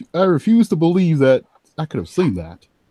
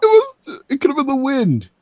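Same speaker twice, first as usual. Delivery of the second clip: scared wavering; 8 semitones higher than usual. Acted. Shaken character denies what she saw.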